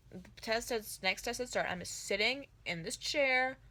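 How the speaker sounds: normal talking voice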